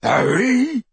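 high, loud mocking copy; mocking mimic. A narrator's reading. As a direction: mimic the call to taunt. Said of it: "mimicked Crabbe".

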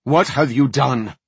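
loud, high accusing demand; forceful, alarmed question. A narrator's reading. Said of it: "Mordant demanded".

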